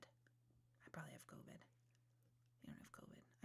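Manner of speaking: breathy whisper to herself; whispered worry. Retold or imitated. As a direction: say it under your breath like a secret fear. Anxious inner thought, then self-reassurance.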